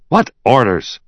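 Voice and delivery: high, sharp bark; barked question. A narrator's reading. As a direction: bark it as a challenge. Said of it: "barked Liebman".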